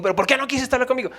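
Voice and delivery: lightly voicing his annoyed wife; mock upset wife.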